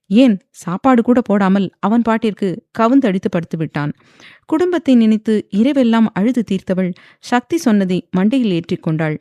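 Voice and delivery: ordinary delivery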